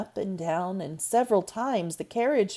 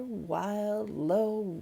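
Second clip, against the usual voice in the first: usual speaking voice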